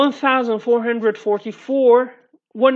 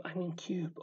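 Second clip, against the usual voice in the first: much softer whispered correction; whispered aside; 5 semitones lower, 17 dB quieter than usual. Real, not acted. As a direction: whisper it to yourself.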